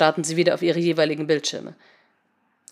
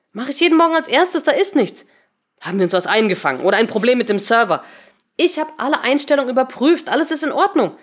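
high, insistent protest; defensive; 6 semitones higher, 4 dB louder than usual. Acted. Colleague defending the daily system checks, defensive protest. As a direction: push back, insisting everything was checked.

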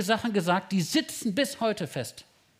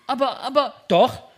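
mock protest; 6 semitones higher, 8 dB louder than usual. Mock quarrel of parents.

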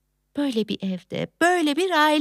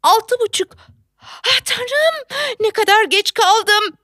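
alarmed; 9 semitones higher, 4 dB louder than usual. Acted. Realizes she is late.

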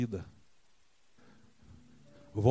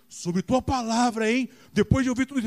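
sarcastic retort; 10 semitones higher, 6 dB louder than usual. Acted. Peter's doubting retort.

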